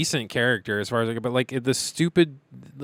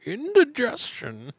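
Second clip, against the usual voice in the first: slow, higher put-on voice; mock posh voice; 12 semitones higher, slower than usual. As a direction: answer like a fussy old aristocrat. Mock voice reply.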